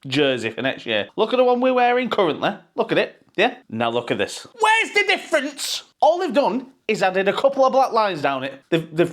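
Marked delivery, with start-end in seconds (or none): 1.2-3.6 much higher, fast, outraged; fed-up fan pointing at the shirt; exasperated. Real, not acted. 4.6-7.7 squeaky high outraged question; incredulous. Real, not acted.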